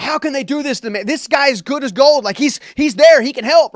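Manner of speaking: high, loud exasperated outburst; telling his dad how wronged he feels, high and loud; retold frustration. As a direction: blurt it out high and loud, fed up with the crew. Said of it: "I'm mad".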